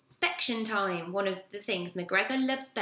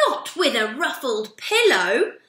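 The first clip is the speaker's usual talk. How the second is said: much higher, much louder, slow dramatic wail; horrified outburst; 8 semitones higher, 14 dB louder, slower than usual. Acted. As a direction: wail it like a true disaster. Fussy manager horrified by pillow.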